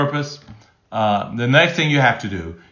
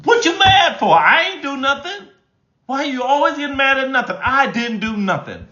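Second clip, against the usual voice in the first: much higher, whiny, defensive complaint; defensive partner; 14 semitones higher than usual. Retold or imitated. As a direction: play the indignant partner who denies everything. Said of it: "your response could be".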